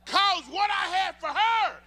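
loud, high and slow; preaching shout. Real, not acted. Preacher to congregation, emphatic shout. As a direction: hit it hard from the pulpit.

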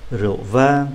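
ordinary delivery

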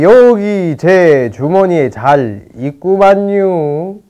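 slow country bumpkin drawl; country bumpkin accent. Acted. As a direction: sound like a slow country boy. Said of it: "너 왜 이렇게 말투가 그래?". (느린 시골 사투리)

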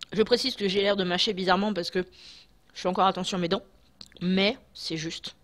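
talking while chewing food; careful chewing between words; mouth full, chewing. Real, not acted. Seen 2: Holds bitten green snack, lips working as if chewing while talking.

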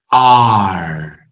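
loud, drawn-out demo "ar" for R; front R. Shown as a demonstration. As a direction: sustain each R with a curled-tongue ending. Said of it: "一定是前R而不是后R".

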